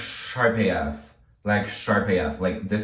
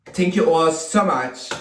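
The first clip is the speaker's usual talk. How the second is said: slow, loud, higher mock Jersey thanks; attempted Jersey accent; 6 semitones higher, 5 dB louder than usual. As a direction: stretch it out like a Jersey local. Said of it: "finish this with a Jersey accent".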